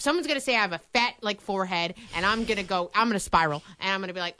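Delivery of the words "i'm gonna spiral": normal talking voice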